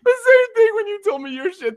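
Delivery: high, squealing laughter through the words; laughing. Real, not acted. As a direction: talk while helplessly cracking up. Cracking up while making comparison.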